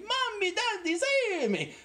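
put-on high-pitched sung cry of children in terror; hammed-up squealed plea; mock scream for help. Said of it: "They scream".

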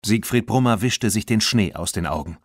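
ordinary delivery

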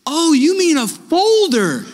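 the penny drops out loud; a loud, slow oh of discovery; sudden realization. Retold or imitated. Realizing the folder is on desk.

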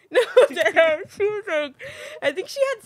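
high, loud burst of laughter through the words; laughing. Real, not acted. Friend reacts to joke about a breakup party, laughing. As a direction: crack up while talking.

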